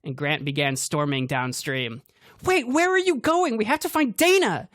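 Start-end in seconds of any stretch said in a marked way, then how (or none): 2.4-4.7 very high, loud yell; yelling after him. A narrator's reading. Cassidy yelling after Grant, very high and loud. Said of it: "Cassidy yelled".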